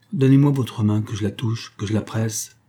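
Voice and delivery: ordinary delivery, nothing marked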